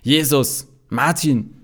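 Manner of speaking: loud yelled call across the house; yelling names. Acted. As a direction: bellow their names through the rectory. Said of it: "brüllt Trixie".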